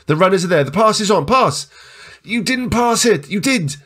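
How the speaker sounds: high-pitched, frantic touchline call; excited, exasperated high calling; urgent urging. Real, not acted. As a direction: urge the pass, then cry out at it. Urging players to pass.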